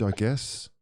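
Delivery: usual speaking voice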